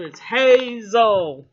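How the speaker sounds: it's hazel drawn out slow and loud; insisting. Real, not acted. Telling people her eyes aren't blue, slow loud insistence.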